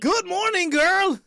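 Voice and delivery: high, loud, whooping hello; excited greeting. Real, not acted. Host greets a familiar caller on air, excited greeting.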